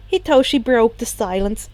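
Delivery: usual speaking voice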